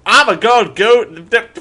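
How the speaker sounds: loud, high mock-American drawl; exaggerated American twang; American accent. Shown as a demonstration. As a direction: burst into a hammed-up American accent. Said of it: "I need material".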